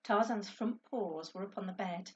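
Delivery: usual speaking voice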